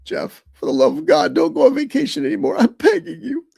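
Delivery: hammed-up begging, climbing high; mock pleading. Mock plea to absent Jeff.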